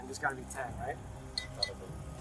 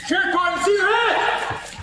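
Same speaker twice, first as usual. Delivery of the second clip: demanding surrender; 10 semitones higher than usual. Real, not acted. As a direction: bark the order at the suspect. Deputy chasing suspects, ordering.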